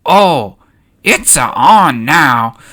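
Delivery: loud, slow declaration of a fight; Jar Jar Binks. Acted. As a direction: throw down the challenge loudly. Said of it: "throws his hands in the air".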